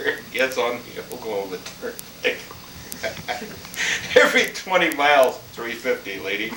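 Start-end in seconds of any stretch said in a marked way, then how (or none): 3.0-6.5 high, laughing between phrases; amused real laughter. Real, not acted. Laughing at toll story.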